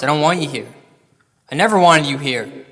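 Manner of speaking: normal talking voice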